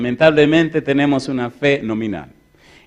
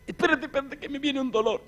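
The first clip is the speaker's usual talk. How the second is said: frantic wife; 12 semitones higher, 9 dB quieter than usual. Retold or imitated. Wife gripping in labour pain.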